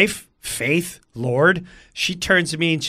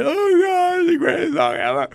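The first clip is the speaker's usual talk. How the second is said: high-pitched weepy fan; hammed-up tearful wail; mock crying; 12 semitones higher than usual. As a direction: imitate a crowd bawling with joy. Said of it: "the crowd's, like, crying".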